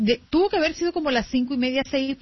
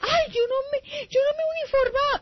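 put-on panicked little girl. Little sister frets she isn't dressed, high childish panic. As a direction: whine like a flustered little girl.

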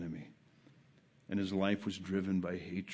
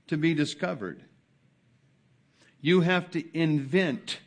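fervent preacher building then fading; preaching at full force; 5 semitones higher, 9 dB louder than usual. Acted. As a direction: hammer each phrase home to the congregation.

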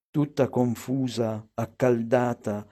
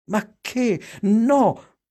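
high, embarrassed protest; mortified mother crying no; flustered refusal; 8 semitones higher, much slower than usual. A narrator's reading.

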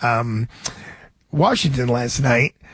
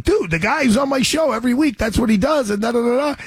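much higher, eager rush of words; excited pitch; 9 semitones higher, faster than usual. Retold or imitated. Host telling his buddy about the show's guest, excited. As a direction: jump in selling it to a friend.

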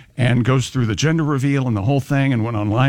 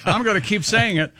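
ordinary talk, nothing marked

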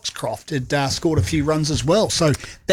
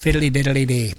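mock Irish accent. Radio host fakes an Irish accent, lightly mocking. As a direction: toss off a silly Irish-sounding ditty. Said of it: "I would like to do an accent".

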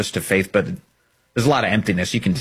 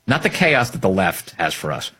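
usual speaking voice

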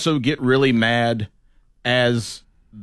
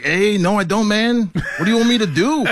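higher mock-defensive buddy voice; exasperated friend; 9 semitones higher than usual. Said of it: "He's like".

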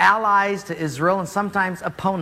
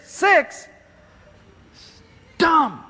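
much higher, outraged "Six?"; indignant disbelief; 8 semitones higher than usual. Acted. Naaman reacting to his servant's count, high outraged disbelief. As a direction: squeak out the disbelief.

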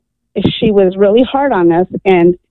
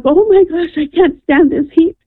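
retold distress; 8 semitones higher than usual.

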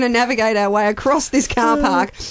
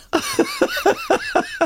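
high squealing laugh; big laugh. Real, not acted. Laughing at daughter's poo remarks.

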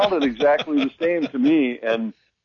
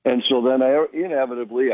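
normal talking voice

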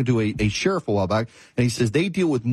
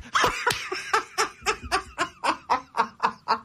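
high burst of real laughter; helpless, high-pitched real laugh; 5 dB quieter than usual. Real, not acted. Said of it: "I started laughing so hard".

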